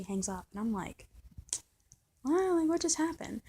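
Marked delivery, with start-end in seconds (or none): 2.2-3.4 bewildered. Retold or imitated. Baffled reply after she hangs up.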